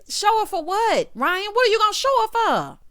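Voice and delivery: high, loud, stretched-out question; indignant challenge. Real, not acted. Confronting Ryan about showing her, loud and indignant. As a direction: demand it loudly, pitch way up.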